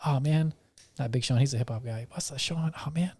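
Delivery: ordinary delivery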